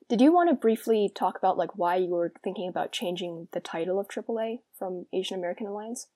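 ordinary talk, nothing marked

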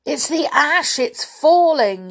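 high, alarmed yell. A narrator's reading. Boy seeing the tree topple, yelling. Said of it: "yelled Julian".

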